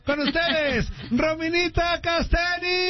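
high, drawn-out announcer shout; big show introduction. Real, not acted. Host bringing a guest on air, rousing announcer call. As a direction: belt out the guest's name like a ring announcer.

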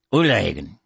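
"Uliagan" opening on oo, no H; Irish pronunciation. Shown as a demonstration. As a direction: show "Uliagan" as an Irish word. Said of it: "from Irish".

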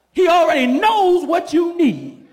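preacher rouses the congregation; preaching at full force. Acted. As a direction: build it up and ring it out.

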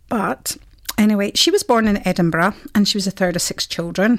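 ordinary talk, nothing marked

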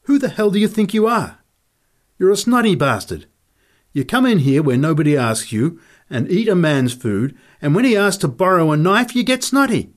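loud, high-pitched furious outburst; angry tirade. Acted. Fighter berates Nick over the knife, loud and furious. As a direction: berate him, pitch and volume flung up.

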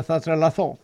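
ordinary delivery

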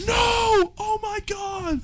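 high, drawn-out mock scream; imitated screaming. Imitating friend screaming in a Cane's, high scream. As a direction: act out a friend's anguished screaming. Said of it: "he was screaming".

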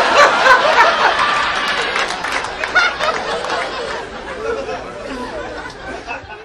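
breathy wheezing laugh. Real, not acted. Comic laughs at own joke.

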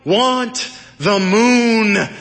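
high, very slow, stretched-out shout; yelled back. A narrator's reading. Woman on a clothesline answering the doorman below, drawn-out yell. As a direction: call back with every word held long. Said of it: "she yelled back".

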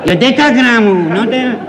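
fussy father quizzing the maternity ward; one side of a phone call, chuckling and nitpicking; pedantic new father on the phone. Acted. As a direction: pedant repeating every detail, half amused. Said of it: "je pedant".

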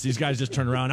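normal talking voice

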